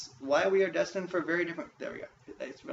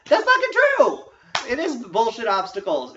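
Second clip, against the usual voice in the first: much higher, louder ranting yell; furious shout; 8 semitones higher, 11 dB louder than usual. Real, not acted. Ranting about life's obstacles, furious shout. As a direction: let the frustration explode.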